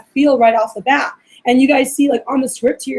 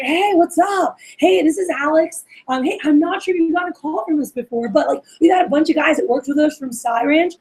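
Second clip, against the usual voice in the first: upbeat, higher-pitched casual greeting; friendly; 4 semitones higher than usual. Shown as a demonstration. Alex calling a buddy's buddy, casual and friendly. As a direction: let personality rule, like calling a friend. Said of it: "talk to them like you're their friend".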